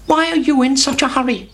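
high fussy hen voice; Henny Penny. Acted. Henny Penny greeting Chicken Licken in a high hen voice. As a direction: chirp it up high like a busy hen. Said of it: "said Henny Penny".

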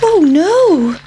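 high, squeaky little pancake voice; a small food creature in a panic; worried pancake. Acted. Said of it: "thought the pancake".

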